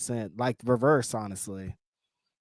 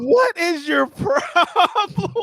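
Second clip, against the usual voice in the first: laughing disbelief; 8 semitones higher, 5 dB louder, much slower than usual. Real, not acted. Mock-outraged at cohost.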